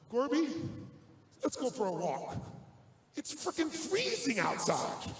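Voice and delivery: a hint of a casual, coaxing president; Reagan inviting. Reagan coaxing Gorbachev out for a walk, lightly voiced. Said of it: "I said to him".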